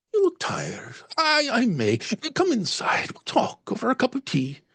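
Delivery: high, kind Irish lilt. Acted. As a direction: invite him in with a gentle Irish lilt. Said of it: "slight Irish lilt".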